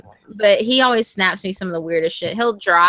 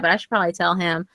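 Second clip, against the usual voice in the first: normal talking voice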